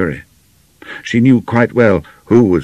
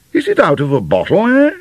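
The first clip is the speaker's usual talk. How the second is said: high, louder suspicious query from a General; 13 semitones higher, 4 dB louder than usual. A narrator's reading. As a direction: question it suspiciously, lifting the final "eh". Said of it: "asked the General suspiciously".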